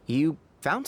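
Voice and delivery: chuckling through the words; a light amused laugh mid-line. Real, not acted. Tighnari amused that they already found food, laughing.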